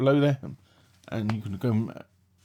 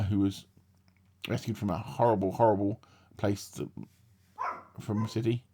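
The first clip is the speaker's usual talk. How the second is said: ordinary talk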